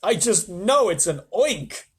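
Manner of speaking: loud, slow; frightened yell. Acted. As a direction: insist in panic. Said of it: "screamed the head of the fire department".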